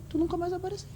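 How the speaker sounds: reply in a small, high put-on voice; retold retort. Quoting own reply to ex-husband.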